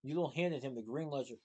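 ordinary delivery, nothing marked

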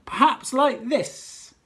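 high, loud, slow grand reveal; head teacher showing off his hat; showbiz reveal. Acted. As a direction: present the hat with a flourish.

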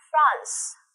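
France modelled slowly, one clear syllable; model pronunciation. Shown as a demonstration. Teacher models France for the students to repeat. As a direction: say France for the class to copy. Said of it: "đọc theo cô nhá".

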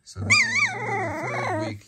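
very high playful repeat; high playful voice for the dogs; high sing-song. Real, not acted. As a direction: lift it way up, playful.

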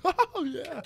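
high, laughing cheer in imitation; mock delighted triumph. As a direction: exult high, laughing through it. Retold friend thrilled by sleep talk.

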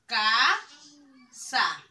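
casa with a long loud CAAA, pause, then sa; drawn-out CA, detached sa; stretched stress on CA. Shown as a demonstration. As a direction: hold the first syllable of casa. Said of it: "La sílaba tónica es la K".

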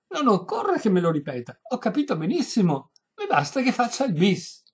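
high, loud, emphatic voice; insistent old lady. A narrator's reading. Deaf lady still wanting encore, high insistent voice. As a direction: brush off the objection loudly. Lady cuts him short.

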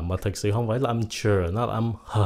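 ordinary talk, nothing marked

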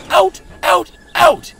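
furious high shout; yelling. A narrator's reading. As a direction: yell him out of the box. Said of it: "yelled the signalman".

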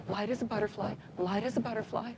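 soft repeated mantra; quiet inner mantra. Retold or imitated. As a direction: murmur it to yourself. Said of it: "I'm thinking".

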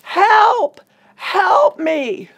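loud, high drawn-out cry for help; high-pitched, full-voiced retold scream. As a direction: scream it out as a desperate call from bed. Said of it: "and I screamed".

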